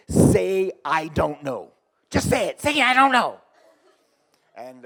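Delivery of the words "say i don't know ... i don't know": imitated yelling. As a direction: re-enact an exasperated boss yelling for an admission. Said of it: "I started yelling, I said".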